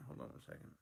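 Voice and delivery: low, mumbled under the breath; quick unvoiced murmur; muttered aside. Real, not acted. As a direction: mutter it to yourself. Muttered, then repeated aloud.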